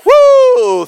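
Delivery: high, loud cheer; joyful whoop. Real, not acted. Preacher whooping for joy to the church. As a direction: let out a happy whoop.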